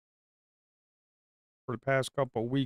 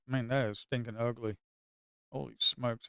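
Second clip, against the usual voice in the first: ordinary talk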